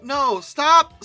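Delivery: usual speaking voice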